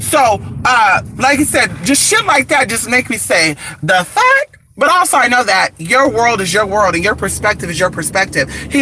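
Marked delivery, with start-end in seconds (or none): 3.8-4.6 high, rising disbelief; shocked "the fuck?" pitched way up; incredulous. Retold or imitated. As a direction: squeal the question in disbelief. Incredulous quoted reaction.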